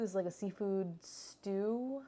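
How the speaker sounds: ordinary delivery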